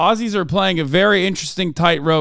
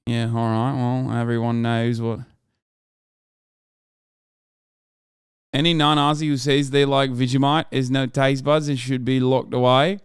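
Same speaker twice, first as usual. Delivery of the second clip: put-on a lower, half-hearted stab at an Australian accent; lightly put-on Australian voice, a bit lower; mock Aussie accent; 3 semitones lower than usual. Streamer reads chat in a mock Australian accent. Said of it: "That's not a fucking accent".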